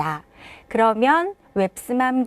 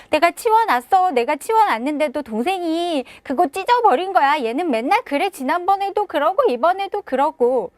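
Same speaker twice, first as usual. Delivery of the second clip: put-on whiny protesting child; 7 semitones higher than usual. Child protests to mom about little brother, high and whiny. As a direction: plead your innocence like a wronged kid.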